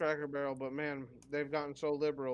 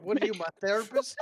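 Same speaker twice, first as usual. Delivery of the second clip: higher, louder indignant retort; mock indignant retort; 7 semitones higher, 9 dB louder than usual. Brother snapping back at the nitpicker, loud and high. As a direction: scoff back at the nag.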